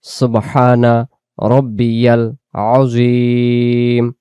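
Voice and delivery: very slow "সুবহানা রব্বিয়াল আযীম", each word drawn out; slow correct recitation. Shown as a demonstration. Teacher modeling the correct ruku tasbih. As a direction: chant "সুবহানা রব্বিয়াল আযীম" slowly and fully. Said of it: "এটাকে এভাবে বলবো".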